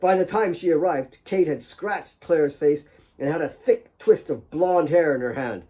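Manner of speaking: usual speaking voice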